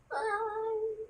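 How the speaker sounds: high squeaky playful why. Real, not acted. Mother cooing to baby.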